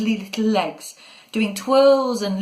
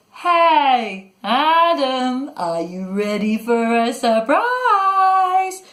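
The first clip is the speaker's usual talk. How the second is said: loud, high, stretched-out sing-song; taunting monster's sing-song call; sing-song shout; 6 semitones higher, 5 dB louder, much slower than usual. A narrator's reading. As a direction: shout it in a long, rising chant. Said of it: "shouting in a sing-song voice".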